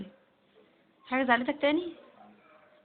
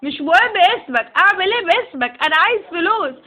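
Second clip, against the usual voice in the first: loud and higher, insisting on money; angry demand; 6 semitones higher, 9 dB louder than usual. Acted. Partner yelling at the star that he wants money, loud angry demand. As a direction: shout the demand for cash.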